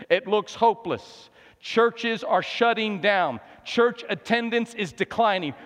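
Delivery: mounting pulpit urgency; impassioned preaching. Real, not acted. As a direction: preach it in driving, measured phrases. Preaching with rising force.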